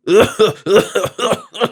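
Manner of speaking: harsh, drawn-out coughing; racking cough. A narrator's reading. Sick miser coughing while the stranger talks. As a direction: cough like it tears the chest.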